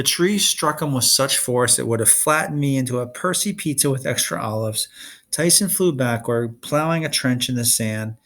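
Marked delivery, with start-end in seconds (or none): none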